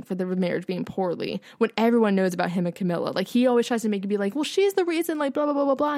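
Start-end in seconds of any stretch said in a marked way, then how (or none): 4.3-5.9 high, dismissive whiny imitation; mocking mimicry. Viewer imitating Charles blaming Diana, mocking. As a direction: parody his excuses in a raised, babbling voice.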